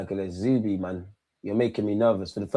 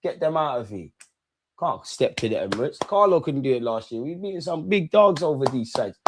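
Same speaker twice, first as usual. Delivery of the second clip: ordinary talk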